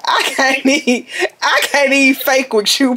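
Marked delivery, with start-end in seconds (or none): none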